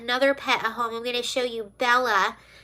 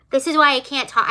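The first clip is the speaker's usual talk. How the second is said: ordinary talk, nothing marked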